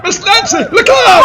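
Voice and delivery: a high, loud cry of warning; alarmed warning shout. Acted. Man warning Miss Nancy of the attackers, alarmed high shout. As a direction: yell to get her out of the way.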